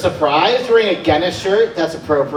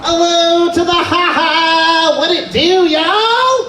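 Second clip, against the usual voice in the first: hype entrance; 7 semitones higher, slower than usual. Real, not acted. Comic greeting the crowd with big hype. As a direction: pump up the room.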